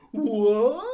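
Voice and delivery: loud, rising wounded cry; pitiful shock. Retold or imitated. Mocking Batman's reaction to being stabbed, pitifully shocked. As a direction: exaggerate the betrayed disbelief. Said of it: "makes the fucking most pitiful face".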